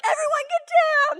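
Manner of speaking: high, loud alarm to the class; urgent shout. Retold or imitated. Teacher ordering thirty kids to take cover, shouting. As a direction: yell a warning to duck.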